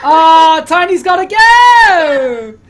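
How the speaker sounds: high, loud, drawn-out playful lament; exaggerated crying-out farewell; mock wail. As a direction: wail hammed-up dismay at a friend leaving. Host mock-laments a player leaving.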